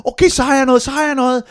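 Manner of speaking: put-on high, loud, excited child voice; eager young boy. Nine-year-old finally has something to say, excited. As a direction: imitate a kid bursting to tell. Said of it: "Valdemar, ni år, begynder".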